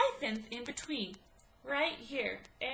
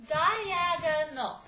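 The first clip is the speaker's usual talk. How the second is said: Diagonal said very slowly and louder, syllables pulled apart; 3 semitones higher, 7 dB louder, much slower than usual. Shown as a demonstration. Teacher to students checking the word, slow and loud. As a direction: sound out Diagonal for the class. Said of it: "Four syllables".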